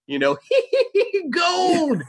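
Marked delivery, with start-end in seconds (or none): 0.4-1.3 put-on very high old-coot cackle; prospector cackle. Imitating the old prospector before crying gold, high cackle. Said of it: "It's that guy". 1.3-2.0 high-pitched gold-crazed prospector cry; old prospector. Acted. Prospector going on about gold, high crazy voice. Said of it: "It's that guy".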